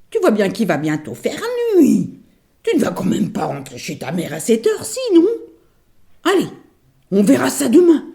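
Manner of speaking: lightly voiced coaxing fake granny; ogress as granny. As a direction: lure her into bed. L'ogresse déguisée en mémé. (fausse mémé enjôleuse)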